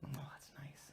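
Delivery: hushed whisper, no voice; whispered aside. Real, not acted. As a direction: murmur it under your breath. Aside to the audience.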